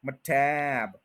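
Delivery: ordinary talk, nothing marked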